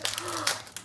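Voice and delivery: high excited gasp; a bright little gasp; delighted gasp. Real, not acted. Sees ghosts on the back.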